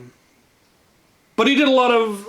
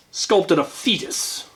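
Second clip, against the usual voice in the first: usual speaking voice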